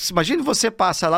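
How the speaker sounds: ordinary delivery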